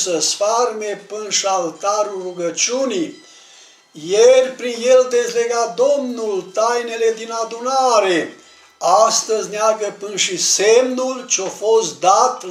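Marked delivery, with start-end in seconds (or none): none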